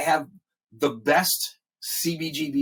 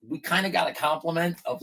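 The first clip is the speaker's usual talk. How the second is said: normal talking voice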